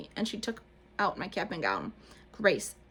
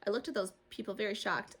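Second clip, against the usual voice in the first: ordinary delivery, nothing marked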